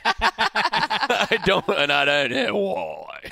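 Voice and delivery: slow, stretched mock Australian; hammed-up Australian drawl; Australian accent. Shown as a demonstration. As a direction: drag out the vowels in an Australian accent. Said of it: "when I do my Australian".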